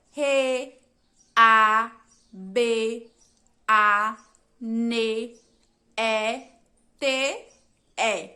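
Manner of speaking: teacher spelling rabanete out loud for the class; spelling out letters. Shown as a demonstration. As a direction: say each letter of rabanete apart, with pauses. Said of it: "quais são as letras da palavra rabanete".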